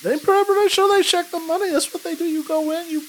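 high, incredulous rant; exasperated. Real, not acted. Player ranting about the bartender, high and exasperated. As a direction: sputter it out in disbelief.

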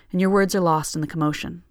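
plain reading voice

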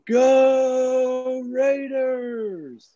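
high, long drawn-out holler; obnoxious fan yell. Retold or imitated. Fan yelling from top of the staircase, obnoxiously. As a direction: imitate a heckler stretching out the team chant. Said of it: "so loud and so obnoxiously".

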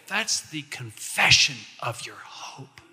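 whisper. Real, not acted. Pastor whispering to the congregation after the chant. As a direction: breathe it out unvoiced.

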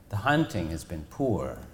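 plain reading voice

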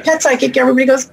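usual speaking voice

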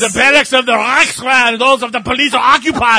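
hammed-up German accent. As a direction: bark it like a cartoon German dictator. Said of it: "read that entire thing in an accent".

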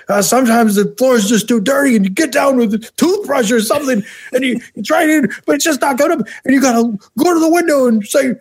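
high, building ranting shout; ranting speech. Retold or imitated. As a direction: rant it up into a yell. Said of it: "where he's like".

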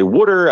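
"water" in a Delaware County accent, like "wooder"; hometown pronunciation. Shown as a demonstration. Showing how they said it growing up, "water" as "wooder". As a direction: say "water" with the first vowel of "wood". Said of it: "I did speak like that".

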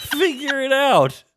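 high, loud, drawn-out shout; exasperated parent yell. Acted. Parent refusing to referee the kids, exasperated yell. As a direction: holler it slowly, each phrase stretched.

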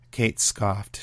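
ordinary delivery

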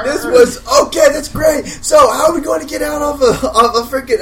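high yelling in panic; panicked shout. Acted. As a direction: shout it while running. Panicked shouting while fleeing.

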